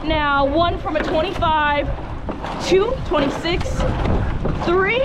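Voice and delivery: rising, driving stroke count; rowing coxswain calls. Real, not acted. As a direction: push the crew through the piece.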